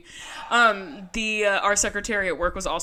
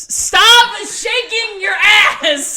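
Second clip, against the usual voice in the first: high, loud, drawn-out laughing yell at the dog; laughing shout; 14 semitones higher, 10 dB louder, much slower than usual. Real, not acted. Owner yelling at her dog to stop wiggling, laughing shout.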